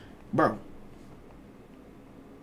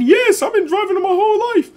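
put-on very high, louder squeaky girl voice; mock sister voice; 5 dB louder than usual. Sister brushing off her brother's worry about driving, high squeaky voice. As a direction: toss it off high and carefree like a careless sister. Sister answering him in the car.

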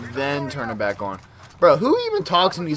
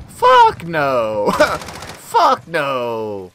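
loud laughing denial; 6 dB louder, much slower than usual. Real, not acted.

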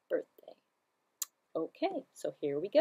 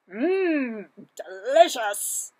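slow savoring mmm; 4 semitones higher, 9 dB louder, much slower than usual. Acted. Cow tastes cake.

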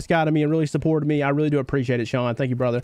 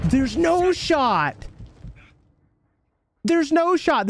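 drawn-out, stunned "no shot"; slow disbelief; 9 semitones higher, much slower than usual. Real, not acted. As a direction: stretch it out, unable to believe it. Disbelief at the final play.

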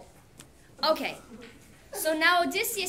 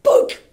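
very loud, high thunk of an arrow striking; arrow thud; 12 semitones higher, 15 dB louder than usual. Acted. Teacher imitates the arrow hitting home. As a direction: punch the impact sound.

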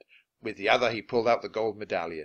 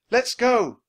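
high, loud call to leave; urgent cry; 12 semitones higher, 9 dB louder than usual. A narrator's reading. Annie urges Jack to flee the storm, crying out. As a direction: cry out to get moving. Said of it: "cried Annie".

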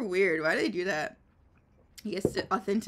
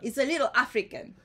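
Jamaican accent; 4 semitones higher, 3 dB louder, faster than usual. Shown as a demonstration. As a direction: demonstrate a Jamaican accent, slightly African. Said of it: "The Jamaican is easy".

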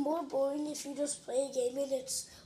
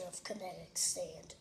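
whispering; 7 semitones lower, 7 dB quieter than usual. Real, not acted. Boasting quietly while playing.

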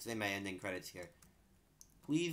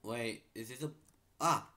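usual speaking voice

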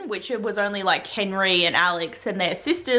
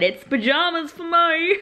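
playful; 7 semitones higher, slower than usual. Real, not acted. Playful aside.

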